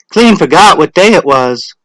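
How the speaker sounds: breezy woman hurrying in late; Missus Hutchinson. A narrator's reading. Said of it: "she said to Missus Delacroix".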